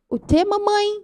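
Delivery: put-on high little toddler voice; tiny high-pitched innocent question; innocent small child. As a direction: imitate a puzzled toddler asking mommy. Voices her two-year-old son's innocent reply.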